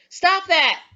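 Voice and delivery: high, loud, emotional command; attention-getting shout. Shown as a demonstration. Trying to stop someone about to get hurt, urgent shout. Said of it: "I'm gonna try to get their attention".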